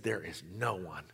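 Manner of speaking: almost breathe it in awe; awed hush. Retold or imitated. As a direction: reverent hushed confession of faith. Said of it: "he said".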